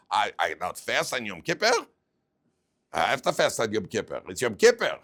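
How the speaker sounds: acted older man, stubborn and loud; put-on indignation, rising to a loud insistence; indignant protest. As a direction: protest as if fasting were non-negotiable. Older man protests doctor's order.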